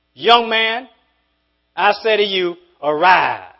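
ringing preacher's command to rise; commanding call. Acted. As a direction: call him up with authority. Jesus raising the dead man.

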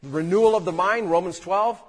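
normal talking voice

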